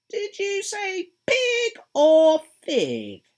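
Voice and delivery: high, loud and very slow teasing cat; Cheshire Cat. Acted. The cat calling down from the branch, slow and high. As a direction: stretch each word out like a grinning cat.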